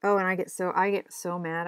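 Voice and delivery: ordinary talk, nothing marked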